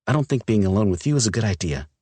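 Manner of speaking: hurt woman spilling her anger; upset young woman. Acted. Olivia's figure voice.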